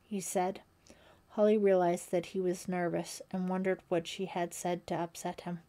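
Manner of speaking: usual speaking voice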